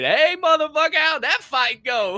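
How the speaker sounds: high, loud mock war buddy hollering; rowdy warrior greeting. Warrior greeting a returning fighter with a rowdy holler. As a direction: call out like a rough comrade at camp.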